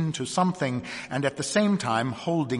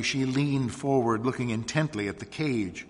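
ordinary delivery

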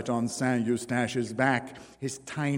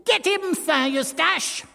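much higher ringing order to attack; high, clear command; 4 dB louder than usual. A narrator's reading. Dwarf orders his dog to attack, high and clear. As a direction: sing out the command. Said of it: "added in a high, clear voice".

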